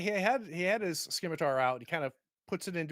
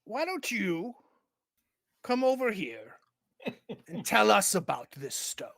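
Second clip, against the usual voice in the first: high, drawn-out beckoning; raised, insinuating captain voice; orc captain; 7 semitones higher, 5 dB louder than usual. Acted. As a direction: coax them over with mock invitation. Orc captain beckons player menacingly.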